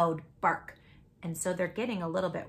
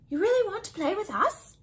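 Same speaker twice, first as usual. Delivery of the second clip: hopeful question; 11 semitones higher, faster than usual. Acted. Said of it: "Jam asked".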